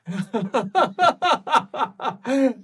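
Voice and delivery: gleeful cackle. Acted. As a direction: cackle with delight. Creature laughs.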